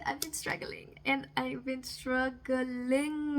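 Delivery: hammed-up slow moan; drawn-out lament. Real, not acted. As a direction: drag the words out in mock despair. Drawn-out lament about struggling.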